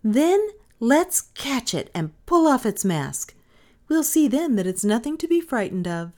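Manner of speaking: put-on high, bright-eyed child daring the others; a brave little boy egging them on; eager child. A narrator's reading. Child urging the others to unmask it, eager.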